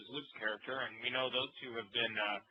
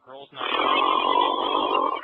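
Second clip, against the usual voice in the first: deep announcer voice; 13 dB louder, much slower than usual. Acted. Announcing pay-per-view title.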